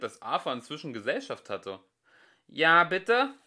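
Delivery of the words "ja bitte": loud, higher-pitched questioning call; calling back. A narrator's reading. Prosser answering a call from across the site, loud and questioning. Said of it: "rief er".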